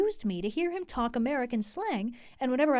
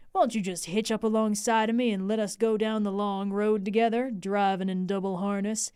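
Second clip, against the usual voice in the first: lower, sweet-talking American Texan slang; sweet American drawl; 4 semitones lower than usual. Acted. Lucy quotes Texan suitor's proposal in sweet American drawl. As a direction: coax her gently in a Texan drawl. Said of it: "ever so sweetly".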